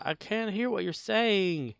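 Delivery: high, drawn-out mock actor; slow high-pitched imitation of a bad line reading; quoted movie actor. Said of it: "the times that they said".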